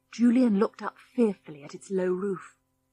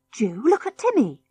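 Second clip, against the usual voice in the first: excited cry; 5 semitones higher than usual. A narrator's reading. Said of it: "cried George".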